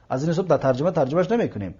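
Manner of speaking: ordinary delivery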